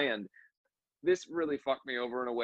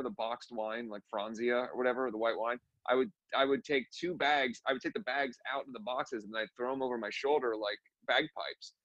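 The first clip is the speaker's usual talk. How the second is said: ordinary talk, nothing marked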